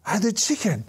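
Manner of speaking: put-on high, gleeful kid voice; delighted young fan. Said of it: "and he says".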